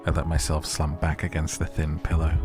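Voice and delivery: usual speaking voice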